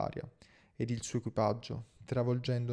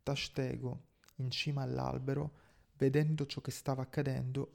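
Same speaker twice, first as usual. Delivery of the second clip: ordinary delivery, nothing marked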